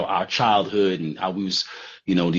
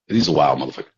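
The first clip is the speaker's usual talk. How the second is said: usual speaking voice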